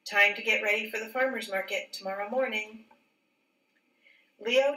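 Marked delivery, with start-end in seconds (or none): none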